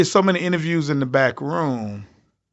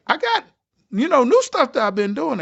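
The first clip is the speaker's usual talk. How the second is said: excited pleading; 11 semitones higher than usual. Retold or imitated. Pitching new material to producers.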